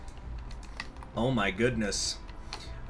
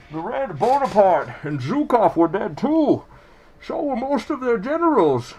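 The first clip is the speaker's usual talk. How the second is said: higher muffled masked villain voice; Bane voice; 10 semitones higher, 6 dB louder than usual. Acted. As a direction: speak as Bane through the mask. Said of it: "We're just gonna do the Bane voice".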